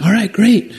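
high, bright mock invitation from the guide; cheerful guide. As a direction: imitate the guide's upbeat "hop on". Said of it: "He's like".

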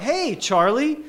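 a touch of an upbeat voice on the phone; cheery caller voice. Retold or imitated. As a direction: greet him like an old friend on the line. Caller greets him on phone.

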